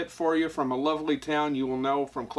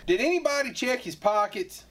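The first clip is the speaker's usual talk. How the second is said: high put-on voice; 8 semitones higher than usual. Skit sheriff voice.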